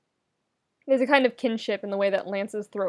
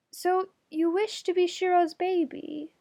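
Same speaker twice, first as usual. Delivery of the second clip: high, formal royal voice; Allura, earnest; 9 semitones higher than usual. Acted. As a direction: ask it with grave sincerity. Allura asks gravely.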